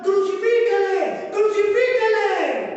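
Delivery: crowd shouting crucify. Retold or imitated. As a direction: call it out like the angry crowd.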